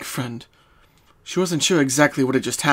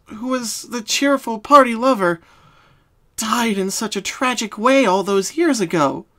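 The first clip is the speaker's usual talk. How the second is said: sad confession; 8 semitones higher, 5 dB louder than usual. Acted. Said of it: "Bellamina sighed".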